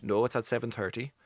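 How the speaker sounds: a Finn calmly correcting; Finnish correction. Retold or imitated. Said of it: "They went".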